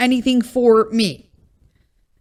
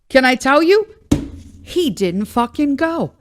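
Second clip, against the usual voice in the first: ordinary delivery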